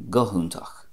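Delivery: "go húntach" as guh HOON-takh; Munster pronunciation. Shown as a demonstration. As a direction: say "go húntach" with an H before the oo. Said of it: "which is a H".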